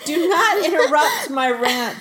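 high-pitched exasperated protest; mock scolding. Real, not acted. Ranter defending the rant from a giggling co-host, mock scolding. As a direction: playfully tell off the interrupter.

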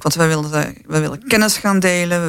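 ordinary talk

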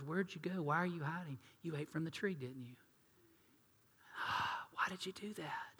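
hushed, disappointed questions; soft grieved whisper. Acted. God asking Adam and Eve why, soft whisper. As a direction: ask it quietly like a let-down parent. God voiced calling the hiders.

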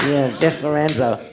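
low curled-lip weirdo; low-pitched lipless veteran; Biff Lorenzo. Acted. Comic reprises his weirdo character, low voice. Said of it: "It's just a fucking weirdo".

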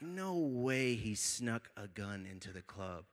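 low, slow, soft dread; hushed disbelief. Retold or imitated. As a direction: murmur it slowly to yourself, stunned. Inner disbelief at possible gun.

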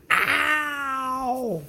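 sharp high yelp of pain; sudden ow. Real, not acted. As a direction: yelp like a cat just clawed you. Cat scratches him.

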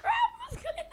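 high, loud granny with a laugh; eighty-year-old grandma. Pretend. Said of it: "[name] will be an eighty-year-old".

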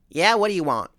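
put-on southern accent. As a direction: homeowner answering the phone, loud southern accent. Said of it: "this little southern accent I hear".

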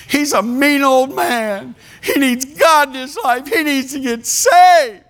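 a hint of an earnest wife's high plea; Joy's urgent plea. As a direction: mimic Joy begging the church to pray. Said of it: "She stood and she said".

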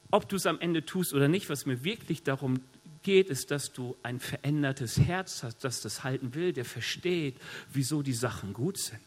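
ordinary talk, nothing marked

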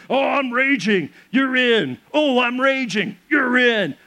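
high, hammed-up fake exasperation; mock raging. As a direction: whine it as a repeated excuse to hand over. Mocking constant handoffs to spouse.

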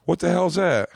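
high-pitched mock outrage; retold angry mom. Mom spotting son's new tattoo, mock-angry. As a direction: imitate a mom's startled demand. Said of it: "She lost her shit on me".

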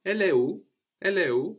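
Elle est où with où rising high at the end; informal question. Shown as a demonstration. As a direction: lift où into a bright casual question. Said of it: "just saying, She is where".

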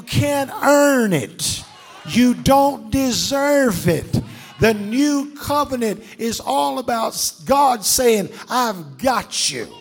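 loud, slow preacher's climax over music; preaching at full force. Acted. Preacher rising to a climax before the congregation. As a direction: drive it home like a pulpit climax.

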